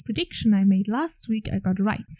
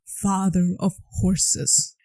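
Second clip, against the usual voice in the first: very slow and solemn; epic title; much slower than usual. Shown as a demonstration. Reading Poseidon's title slowly for viewers. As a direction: stretch the words like a grand proclamation. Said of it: "The last part was not said".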